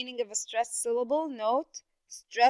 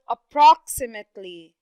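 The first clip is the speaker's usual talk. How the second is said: approximately with PROX punched louder; stress on second syllable; 8 dB louder than usual. Shown as a demonstration. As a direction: lean hard on the "prox" in approximately. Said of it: "which is the second syllable".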